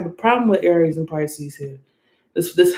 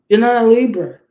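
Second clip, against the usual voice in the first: louder, punched repeat of "You're not a Libra"; loud emphatic repeat; 3 semitones higher, 6 dB louder than usual. Shown as a demonstration. Reader insists to a Pisces, in a loud emphatic repeat. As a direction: hammer it home the second time.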